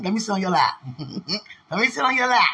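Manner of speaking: laughing, increasingly insistent coaxing; playful begging. Real, not acted. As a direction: beg teasingly through giggles.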